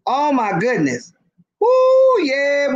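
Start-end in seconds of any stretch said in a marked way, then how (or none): 1.6-2.7 excited whoop. Real, not acted. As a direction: whoop to fire up the room. Hyped to get the party going.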